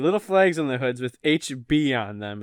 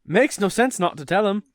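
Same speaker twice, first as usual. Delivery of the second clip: lilting attempt at British, drifting Irish; British accent slipping Irish; 3 semitones higher than usual. Reading Mr Weasley arguing with his wife, amateur British-Irish accent. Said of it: "the Irish thing".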